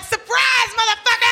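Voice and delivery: spring it on him like a gotcha; gleeful shout. Retold or imitated. Jumping into the friend's cab, a gleeful taunting shout. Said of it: "and I'm like".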